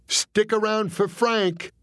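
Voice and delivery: high, slow nervous retry of the line. Retold or imitated. Comic nervously repeating his joke to the mobster, high and slow. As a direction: say it again, hoping it lands. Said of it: "I tried again".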